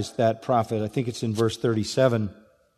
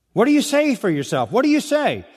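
pressing demand; 9 semitones higher, 3 dB louder than usual. Retold or imitated. Delegation pressing John again.

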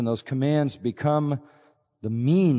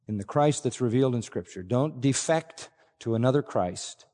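usual speaking voice

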